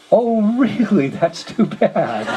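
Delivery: high, drawn-out fake condolence; mock sympathy. Visitor hearing the old guy died, mock sympathy. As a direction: draw out a put-on "too bad". Feigned sympathy at news, audience laughs.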